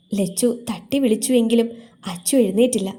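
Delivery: ordinary delivery, nothing marked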